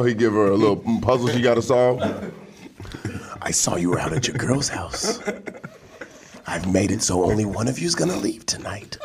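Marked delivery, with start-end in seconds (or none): 3.4-9.0 low, quiet sinister villain; calm menacing horror villain; Jigsaw. Pretend. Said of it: "Oh, you mean like Jigsaw?".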